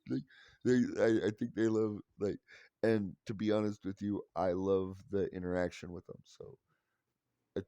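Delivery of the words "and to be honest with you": ordinary talk, nothing marked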